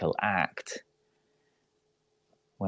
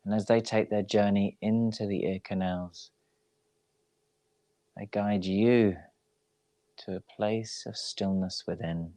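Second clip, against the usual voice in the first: guided meditation. Real, not acted. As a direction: ease them into rest, unhurried and even. Leads a yoga nidra relaxation.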